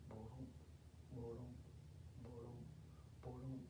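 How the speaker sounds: very low, soft tortoise steps; low, quiet plodding sound. Acted. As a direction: murmur heavy little footfalls. Tortoise passes the sleeping hare.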